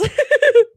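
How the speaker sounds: squealing burst of laughter; high shriek laugh. Real, not acted.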